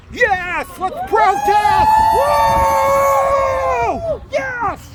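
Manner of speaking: high, loud whooping cheer; excited cheer. Real, not acted. Cheering the women protesting as they pass. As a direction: cheer the protest on at the top of your voice.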